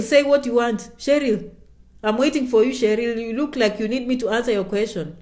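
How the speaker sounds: normal talking voice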